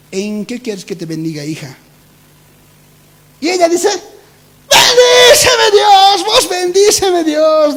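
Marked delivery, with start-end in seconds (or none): 0.1-1.8 gentle God voice. Retold or imitated. 4.7-7.8 high, loud, crying plea, drawn out slow; crying shout. Retold or imitated. Sister in her dream wailing at God for blessing.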